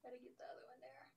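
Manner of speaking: fast, whispered to herself; whispering. Real, not acted. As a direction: whisper it while concentrating. Whispers to herself while drawing.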